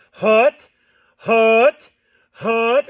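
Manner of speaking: loud, sharp burst of air on each hut; sharp reference word. Shown as a demonstration. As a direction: snap the tongue and punch out each hut. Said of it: "drawing out the first part of the note".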